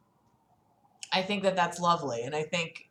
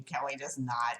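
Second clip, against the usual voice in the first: soft plea; 8 semitones lower, 12 dB quieter than usual. Real, not acted. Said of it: "I don't like that word".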